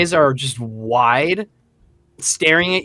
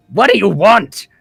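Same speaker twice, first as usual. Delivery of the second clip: lightly voiced irritated patron; louder, a touch annoyed at being bothered; annoyed shepherd; 3 semitones higher, 6 dB louder than usual. Shepherd turning on the man slapping his back, annoyed. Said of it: "the shepherd finally, like, looks over and says".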